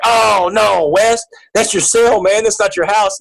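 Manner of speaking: mock protest. Voicing inmates' teasing objection.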